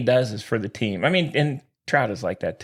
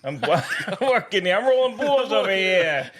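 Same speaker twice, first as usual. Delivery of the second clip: higher, laughing mock New York accent; 5 semitones higher than usual. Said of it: "New York accent".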